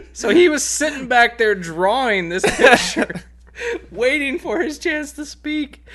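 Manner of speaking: high, slow, laughing through it. Real, not acted. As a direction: laugh out the words. Laughing at funny detail.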